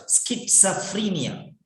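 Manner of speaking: schizophrenia said slowly as skit-suh-FREE-nia; correct pronunciation. Shown as a demonstration. Teacher models the word for students, careful skit-suh-free-nia. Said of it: "don't know how to pronounce this word".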